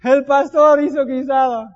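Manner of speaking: hammed-up high, loud cry of horror; family joking about the pastor's stew, shouting high and slow; mock family shout. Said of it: "Mi familia grita".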